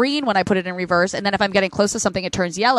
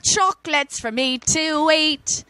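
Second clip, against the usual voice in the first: sung-speak Eliza Doolittle Cockney; slow, higher Cockney lilt; Cockney accent; 6 semitones higher, slower than usual. Pretend. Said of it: "your best Cockney".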